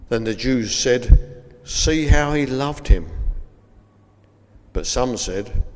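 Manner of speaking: measured Bible reading with solemn pauses; scripture reading. Acted. Preacher reads the Lazarus passage to listeners, solemn scripture reading. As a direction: read the passage out gravely, phrase by phrase.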